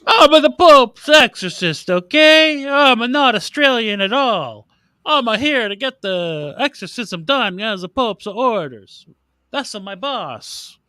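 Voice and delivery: slow, high hammed-up Italian accent; mock Italian accent. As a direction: lampoon Russell Crowe's Italian priest. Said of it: "Russell Crowe with an Italian accent".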